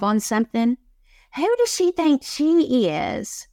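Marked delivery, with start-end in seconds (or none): 1.4-3.5 high, sneering gossip voice, slower on the first line; mock the catty colleagues who resent her; jealous coworkers. Same inner critic voice again.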